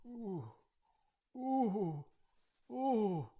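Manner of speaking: high, drawn-out moans; feeble old-man groans. Acted. Isaac on his deathbed, high feeble groaning.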